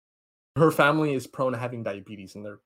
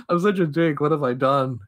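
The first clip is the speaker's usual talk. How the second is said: higher, louder imitated wail of guilt; hammed-up tearful self-reproach; mock crying; 3 semitones higher, 5 dB louder than usual. Guy who chose who dies, mock crying. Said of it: "the guy's just crying".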